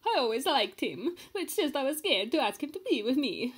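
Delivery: lightly done bad Italian accent; a hint of a fake Italian accent; thinking aloud in a badly done Italian accent. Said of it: "in a really badly done Italian accent".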